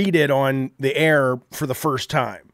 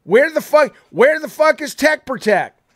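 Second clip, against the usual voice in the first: exasperated yell; 9 semitones higher, 5 dB louder than usual. Retold or imitated. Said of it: "I'm like".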